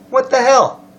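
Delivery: acted exasperation. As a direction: read it as a baffled protest. Reading a GM's exasperated complaint.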